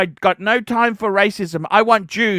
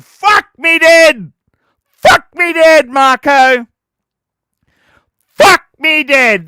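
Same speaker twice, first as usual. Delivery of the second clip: slow, loud, higher disgusted yell; exasperated shout; 4 semitones higher, 5 dB louder than usual. Acted. Streamer fed up with Marco, slow loud yell. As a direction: bellow it in disbelief.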